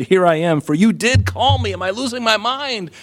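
put-on high, bewildered young Samuel; a puzzled kid woken at night; confused boy voice.

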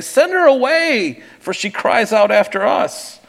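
high, loud plea; urgent demand. Acted. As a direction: act out exasperated disciples urging. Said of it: "his disciples came and urged him".

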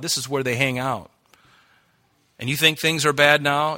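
ordinary talk

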